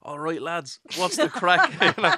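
a lightly exaggerated broad Dublin accent; casual Dublin greeting, a touch hammed-up. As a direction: play up the Dublin accent for the lads. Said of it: "You literally go the opposite way".

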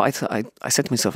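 normal talking voice

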